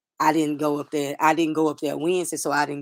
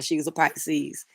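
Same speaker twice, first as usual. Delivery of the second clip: usual speaking voice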